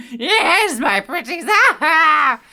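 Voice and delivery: high, loud, slow witch through laughter; laughing witch voice. Pretend. Reader voices Megan as a witch, laughing. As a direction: purr it like the Wicked Witch, cracking up. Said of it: "this witch just shows up".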